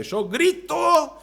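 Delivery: slow, drawn-out mock yell; mock controlled yell. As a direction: stretch it out as a restrained shout. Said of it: "Yo no grito fuertemente". (grito fingido, controlado)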